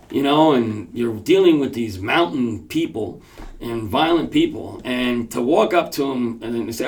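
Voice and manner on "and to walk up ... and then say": ordinary delivery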